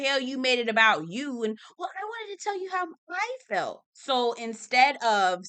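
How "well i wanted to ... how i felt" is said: high, soft put-on voice; mocking Dorit. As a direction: parody her self-pity.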